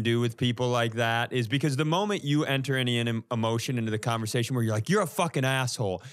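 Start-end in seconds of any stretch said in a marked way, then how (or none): none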